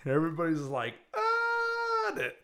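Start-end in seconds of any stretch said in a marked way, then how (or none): 1.2-2.1 very high mock scream of horror. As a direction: scream like horrified onlookers, hammed up. Voicing horrified diners screaming.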